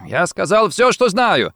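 high, pleading insistence; desperate protest. A narrator's reading. Rossetti claims he told all, desperately protesting. Cornered banker protests interrogation.

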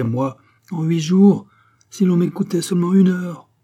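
high, loud imperious tirade; vehement. A narrator's reading. Queen urging king against the rebels, vehement and loud. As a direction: demand action with rising force.

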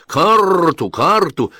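high rolling parrot squawk. A narrator's reading. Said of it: "раскатисто произнёс". (раскатистый голос попугая)

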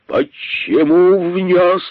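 медленно, громко, обвиняюще; король гневно выкрикивает вопрос; крик. Acted. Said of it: "закричал король". (король обвиняюще кричит на находчивого, медленно и громко)

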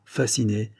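ordinary delivery

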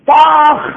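loud, high mock smack; slipper smack sound. Father hitting daughter with a slipper, loud smack. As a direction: shout the slap out. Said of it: "جايب أقدم شبشب".

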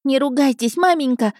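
put-on high, pleading kid voice; put-on boyish yelp; squealing boy. A narrator's reading. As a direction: squeal it at an angry mum. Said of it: "заверещал парнишка в картузе".

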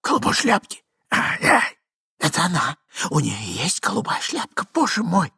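high, panicky exclamation; high-pitched mock-horrified outburst; alarmed cry. A narrator's reading. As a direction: cry out in dismay without full volume. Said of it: "закричал Иван Андреевич".

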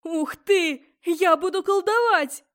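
put-on loud, high-pitched excited boy; thrilled. Boy thinks he will do magic, thrilled. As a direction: burst out with delight, high and loud.

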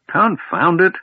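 put-on high, loud grumpy snarl of an old man woken up. A narrator's reading. Old man woken from his nap, snarling at the noisy kender. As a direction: bark it out, irritated at the noise. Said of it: "snarled a voice below the kender".

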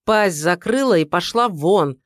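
loud angry bark; barked order. Acted. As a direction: snap it at her like a dog. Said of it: "рявкнула Валентина".

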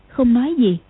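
ordinary delivery

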